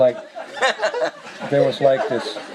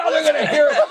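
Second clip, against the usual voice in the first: giggly warning; 12 semitones higher than usual. Retold or imitated. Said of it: "I said".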